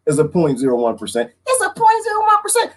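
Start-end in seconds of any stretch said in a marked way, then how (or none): 1.5-2.7 high alarm. Shown as a demonstration. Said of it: "repeated in character voice".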